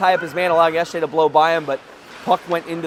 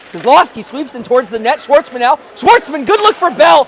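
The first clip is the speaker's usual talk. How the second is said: louder, higher, building excitement; rising call on the chance; excited play-by-play; 6 semitones higher, 6 dB louder than usual. Real, not acted.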